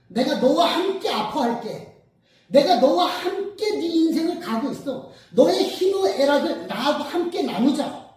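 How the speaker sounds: impassioned, loud and high preacher's plea; quoted Jesus. Retold or imitated. Jesus offering to share the suffering, loud impassioned plea. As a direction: plead loudly, leaning on the drawn-out words. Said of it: "라고 말씀하시는 거예요".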